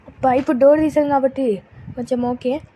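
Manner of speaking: usual speaking voice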